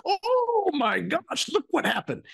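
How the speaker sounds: high delighted exclamation; delighted mock surprise. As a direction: gush with happy surprise. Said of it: "it's like".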